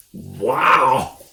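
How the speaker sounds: loud gasp of wonder; gasping wow. A narrator's reading. The smallest fox gasps after a gulp of cider. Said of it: "he gasped".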